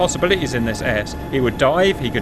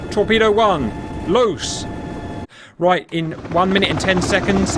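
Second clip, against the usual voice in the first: high, triumphant call; excited; 6 semitones higher, slower than usual. Real, not acted. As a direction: launch it with a thrill. Fires first torpedo.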